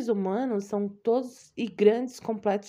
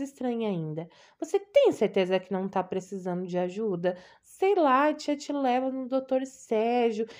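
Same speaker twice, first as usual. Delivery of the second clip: doting aunt; 3 semitones higher than usual. Acted. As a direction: gush over her like an anxious old aunt. Aunt voice again.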